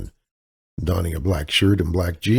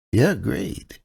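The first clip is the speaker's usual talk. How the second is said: damsel persona; 6 semitones higher than usual. Acted. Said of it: "her damsel in distress persona".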